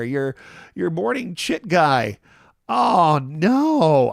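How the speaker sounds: high, loud melodramatic lament; mock wailing apology. Host apologizing to viewers for getting dark again, hammed-up wail. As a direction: wail a hammed-up apology, drawing out the big words.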